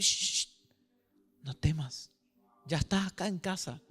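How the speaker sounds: low, soft "shh" then tender reassurance; calm a frightened child home at last; gentle hushing. Retold or imitated. God welcoming the believer home, soft and soothing. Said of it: "Dios te va a decir".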